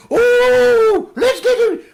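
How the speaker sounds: very high, loud shrill-free squeal of a mum; hammed-up mum impression; mum's voice. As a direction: shriek it like his mum at dinner. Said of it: "my mum would be like".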